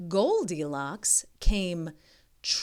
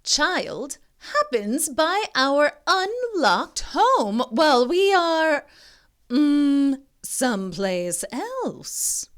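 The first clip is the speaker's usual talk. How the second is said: high, slow villainous mama voice; scheming mama dinosaur; 7 semitones higher, 7 dB louder, slower than usual. A narrator's reading. Mama Dinosaur plotting over an innocent child, scheming. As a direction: savour each word like a hungry villain.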